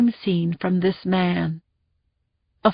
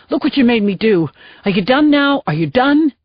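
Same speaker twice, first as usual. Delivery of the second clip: loud, higher furious yelling; angry shouting; 3 semitones higher, 4 dB louder than usual. Acted. Jacoby shouting at the tased man, angry. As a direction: berate the man on the ground.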